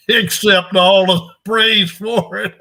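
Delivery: loud, high, slow through a laugh. Real, not acted. Coach joking about taking all the praise, laughing. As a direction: let the joke break into laughter.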